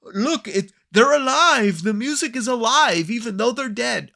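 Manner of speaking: high, loud, hammed-up raving; mocking over-the-top exclamation; mock crazy maestro. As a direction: rave like a deranged showman, pitched high. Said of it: "this idiot's all like".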